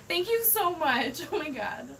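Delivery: high-pitched heartfelt thanks; overwhelmed gratitude. Real, not acted. Streamer flustered by so much money, gushing gratitude. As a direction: gush thanks, pitched well up.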